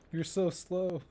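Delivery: ordinary talk, nothing marked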